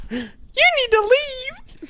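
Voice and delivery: shrieked way up high and slow. Pretend. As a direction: shriek it in a squeaky falsetto.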